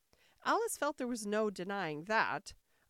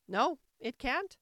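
higher, louder, slow no; firm queen; 6 semitones higher, 6 dB louder than usual. A narrator's reading. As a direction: flatly refuse her. Queen contradicts sharply.

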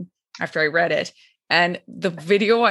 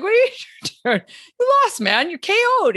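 high incredulous; 11 semitones higher, faster than usual. Retold or imitated. Voicing referees, high.

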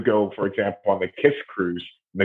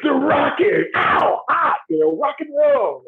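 a much higher, shouted rock-star caricature; mock rock star; slower than usual. Mocking a shallow rock-star answer.

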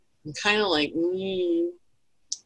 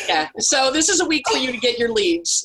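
said through laughter, higher; laughing; 10 semitones higher, 4 dB louder than usual. Real, not acted. Still laughing at the joke, telling listeners to get leads. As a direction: talk while still cracking up.

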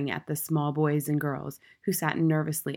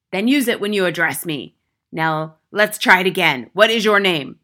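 loud, stern interrogation; domineering schoolmistress; tyrannical headmistress, demanding; 10 dB louder than usual. A narrator's reading. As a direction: demand it sharply of the boy.

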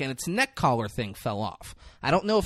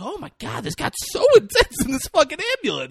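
mock disbelief. Mock reaction to the escalation.

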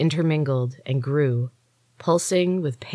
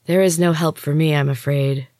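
ordinary delivery, nothing marked

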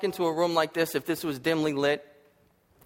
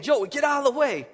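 retold urgent warning; 11 semitones higher than usual. Driver warns helper while car stuck.

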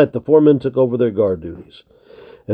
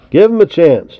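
higher and louder, firmly taking his side; defensive; 7 semitones higher, 6 dB louder than usual. Acted. Tom defending the greenhorn against Wolf, firm and raised. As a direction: stand up for him, firm and raised. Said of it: "defended Tom".

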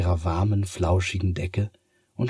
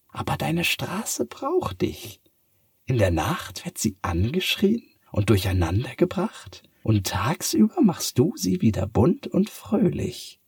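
cat voice; 8 semitones higher than usual. Acted. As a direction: reassure her that her street needs her. Cat encourages Sophia. (hohe, aufmunternde Katzenstimme)

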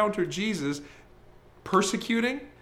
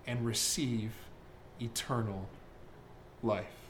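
slow, soft, ever lower; reverent reading; 5 semitones lower, 9 dB quieter, slower than usual. Acted. Preacher closing the verse in hushed reverence, slow and soft. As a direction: let each word fall gently. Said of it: "slow reverent verse reading".

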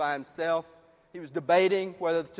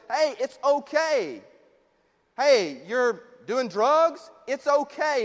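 raised pitch, drawn-out mock reassurance; slow, higher, hammed-up acceptance; mock permissive; 6 semitones higher, 5 dB louder, slower than usual. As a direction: excuse it all with exaggerated ease. Voicing an overly permissive church.

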